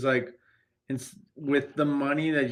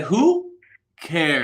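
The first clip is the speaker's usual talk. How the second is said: mock dismissive retort; 6 dB louder than usual. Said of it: "I would've said".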